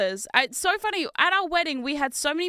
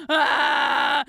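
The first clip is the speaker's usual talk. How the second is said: put-on loud wailing child's cry; loud crying shout; crying scream; 3 semitones higher, 6 dB louder than usual. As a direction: yell through tears like a toddler. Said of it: "scream yelling at me".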